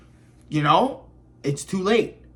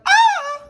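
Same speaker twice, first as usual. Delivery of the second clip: very high, loud "ah"; comic startled squeal; mock scream; 6 dB louder than usual. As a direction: let out a sudden high-pitched fake shriek. Acts out a blind girl's reaction.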